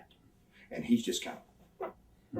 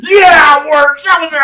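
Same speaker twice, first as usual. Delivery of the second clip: Lex protesting; 12 dB louder than usual. Retold or imitated. Lex insisting to the Colonel he wrestled, high loud hammed-up whining shout. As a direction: whine it out like your feelings are hurt. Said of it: "He's like".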